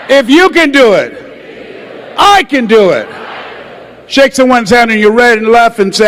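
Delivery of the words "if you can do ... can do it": with conviction. Shown as a demonstration. Leading the crowd, saying it with full conviction. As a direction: declare it like you mean it. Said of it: "say it with conviction".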